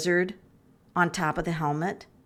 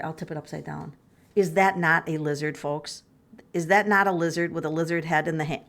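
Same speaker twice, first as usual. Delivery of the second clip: usual speaking voice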